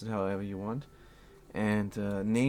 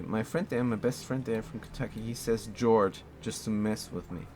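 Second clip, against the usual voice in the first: ordinary delivery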